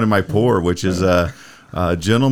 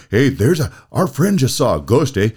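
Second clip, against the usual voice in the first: Canadian hoser voice. Retold or imitated. Retelling the bathroom guys to podcast friends, a mock Canadian accent. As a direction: mimic stoned Canadian guys, lightly, in a Canadian accent. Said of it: "like, an imitation of Bob and Doug McKenzie".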